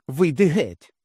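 high, loud command; furious order. A narrator's reading. Wife ordering husband out, high and loud. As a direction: throw him out.

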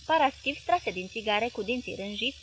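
usual speaking voice

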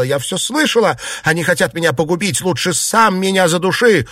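high, agitated lament; distraught high-pitched complaint; wailing lament. A narrator's reading. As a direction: wail it out, pitched well up. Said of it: "запричитала".

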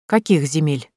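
wary, restrained question; настороженно. A narrator's reading. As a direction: ask guardedly. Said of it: "настороженно поинтересовалась Таня". (настороженно, с опаской)

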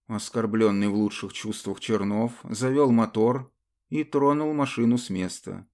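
plain reading voice

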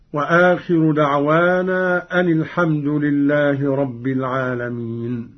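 ordinary talk, nothing marked

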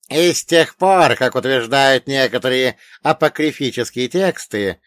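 high, loud elder quizzing a pupil; old storyteller pitched high and forceful; wise old dead man. Acted. Old man resumes his account.

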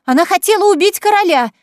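high, loud, rising frenzy; building, breathless accusation; trembling with excitement. Acted. Sister denouncing Kaitlyn to the officer, excited rising shout. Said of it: "её голос дрожал от возбуждения".

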